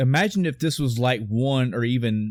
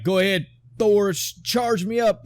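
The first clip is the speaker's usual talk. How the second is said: raised, higher-pitched mock battle call; calling to an ally; 8 semitones higher than usual. Hero asking Thor for lightning, imitated battle call.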